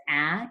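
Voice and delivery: "ah" said open and short, like the a in apple; short a sound. Shown as a demonstration. Teacher showing the class the short A, a clear "ah". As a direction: give the pure short "ah" of apple. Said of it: "an A that says".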